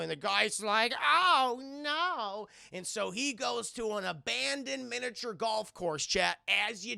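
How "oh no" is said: high, loud mock gasp of dismay; panicked victim. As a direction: squeal it like a cartoon victim. Victim in acted story.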